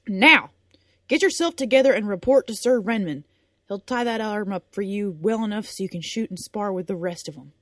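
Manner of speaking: loud, sharp order; loud command. Acted. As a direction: snap the final order. Din's loud final order.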